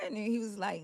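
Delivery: normal talking voice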